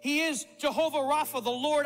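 ordinary talk, nothing marked

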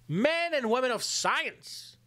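usual speaking voice

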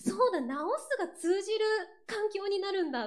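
high, loud burst of anticipation; giddy excitement. Retold or imitated. Singer recalls last night's thought before the Kyushu show, excitedly. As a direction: relive an excited realisation, pitched right up. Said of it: "すごいウキウキしてて".

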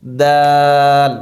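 দাল with the আ held very long and slow; demonstrating a three-alif stretch on দাল; long stretch on the vowel. Shown as a demonstration. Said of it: "তিন আলিফ টান দিতে হবে".

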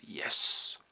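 unvoiced gleeful hiss of yes; a villain's secret whispered yes; whispered triumph. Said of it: "Franz goes".

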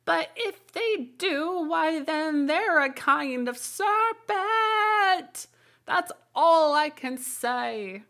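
very high, slow, half-sung bird voice; sing-song pigeon. A narrator's reading. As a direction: chant the logic like a smug pigeon.

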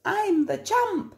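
high, boastful dog voice; high cheeky boast; proud dog. Acted. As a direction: brag about the bone.

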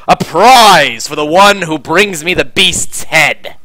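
loud, slow, grand proclamation; stretched, theatrical commander's challenge; proclaiming commander. Acted. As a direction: announce it to the whole hall.

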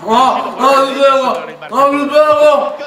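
slow, high, loud protest; pained shout. Real, not acted. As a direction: drag out a pained yell. Cold ice in mouth, protesting.

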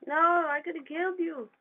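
loud, high and slow wail of protest; frustrated outcry. Real, not acted. Gamer killed by an enemy, slow loud wail. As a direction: drag it out in disbelief at being killed.

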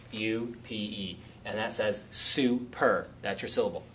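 ordinary talk, nothing marked

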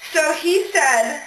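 ordinary talk, nothing marked

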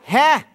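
one short local "Ha."; Hawaiian "Ha". Shown as a demonstration. Showing how short locals keep it, a quick "Ha.".

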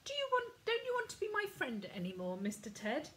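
put-on high, fast child's voice; a small boy begging his grumpy teddy; little boy pleading. Ben asks his teddy, high, fast child's voice. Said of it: "asked Ben".

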